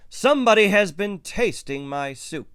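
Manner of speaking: high, loud storybook roar. A narrator's reading. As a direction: roar it as an outraged Papa Bear. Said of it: "he roared".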